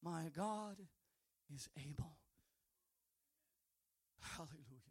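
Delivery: whispered, soft reverent line; preacher's awed hush; hushed preaching. Acted. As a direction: drop to a whisper for the congregation.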